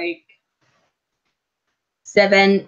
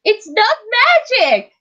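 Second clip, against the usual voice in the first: high, slow, mock-outraged protest; mock indignant; 12 semitones higher, 3 dB louder than usual. Teasing her co-streamer, hammed-up high protest. Playful squabble over a name.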